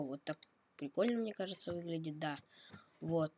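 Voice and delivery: usual speaking voice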